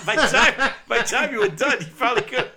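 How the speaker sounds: loud, high, cracking up while talking; laughing. Real, not acted. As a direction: push the joke out through laughter. Cracking up at own joke.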